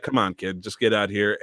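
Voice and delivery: low, soft, understated robot line; retold robot dismissal. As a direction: quietly shoo the kid away as the robot. Retold robot brushing kid off.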